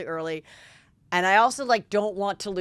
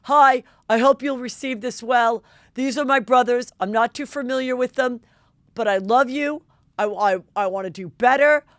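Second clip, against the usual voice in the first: higher, louder hammed-up sincere boyfriend; mock earnest apology; 4 semitones higher, 7 dB louder than usual. Boyfriend filming an apology to his ex, hammed-up earnest. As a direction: overplay a man pleading to win her back. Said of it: "he's like".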